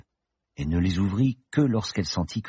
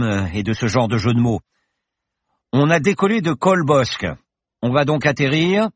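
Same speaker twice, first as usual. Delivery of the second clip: high, bright-spirited professor voice, louder; the confident pilot professor, merry; cheerful professor; 8 semitones higher, 8 dB louder than usual. Acted. Professor reassures Paloma in the plane, jaunty and loud.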